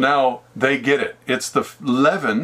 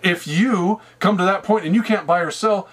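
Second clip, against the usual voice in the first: ordinary delivery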